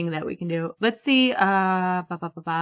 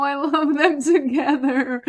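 giggling delightedly through it; laughing; 5 semitones higher, slower than usual. Real, not acted. Gamer delighted by her Sims, laughing. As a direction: laugh as you say it, charmed. Laughs while watching couple.

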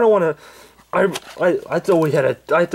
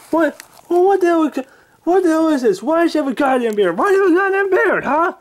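put-on high, loud, cranky old man yelling; Angry Grandpa voice; 3 dB louder than usual. Old man woken by pranksters, high cranky yelling. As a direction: bark at the intruders like a furious grandpa.

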